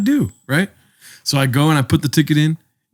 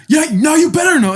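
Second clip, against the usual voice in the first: high, loud imitated snap at the driver; mock angry snap; 4 dB louder than usual. Attendant snapping back at the driver, mock angry. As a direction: throw it back like a sore winner. Attendant gets upset again.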